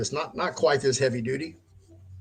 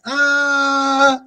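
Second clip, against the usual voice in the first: high falling cry; 8 dB louder than usual. Retold or imitated. Blacking out, falling.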